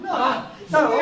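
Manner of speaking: usual speaking voice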